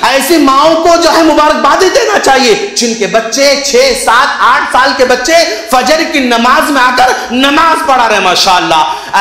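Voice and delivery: high, ringing sermon delivery; fervent preaching praise. Acted. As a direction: hail these parents from the pulpit. (जोश भरी तारीफ़)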